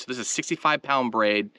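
normal talking voice

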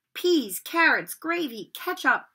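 plain reading voice

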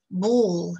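usual speaking voice

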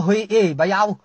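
loud put-on call imitating Yau; hammed-up imitation of Yau calling out; mock shout in another voice; mimicking Yau. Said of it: "Mula kau dia".

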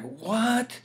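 a startled what at the revelation; stunned what. Retold or imitated. Retold moment of shock.

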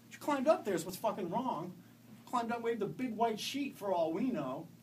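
angry. A narrator's reading. Father angrily answers mother.

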